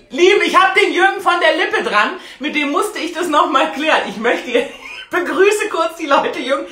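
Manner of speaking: loud words through giggles; laughing. Real, not acted. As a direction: explain while still giggling. Lacht beim Erklären.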